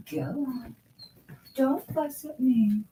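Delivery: high, slow, mock-scolding pet talk; high dog-scolding voice. Real, not acted. Shooing her dog off, high slow mock scolding.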